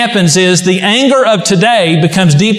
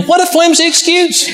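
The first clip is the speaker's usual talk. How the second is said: higher, mocking scoff; mock scorn; 9 semitones higher than usual. Preacher mocks the disciples' fear with a higher scoff. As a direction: scoff at the flimsy excuse.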